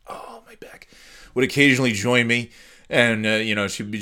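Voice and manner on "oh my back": soft unvoiced groan of back pain; whispered aside. Real, not acted. Standing all day, whispering about his sore back. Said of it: "aside about his back".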